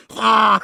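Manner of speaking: loud mock cry; hammed-up ah; mock shark yell. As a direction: yelp like the shark. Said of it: "he's probably going".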